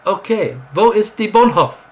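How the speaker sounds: high, loud baffled repeat; lost tourist asking the question again; confused tourist. Pretend. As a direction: ask again, totally lost. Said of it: "you were still standing there going".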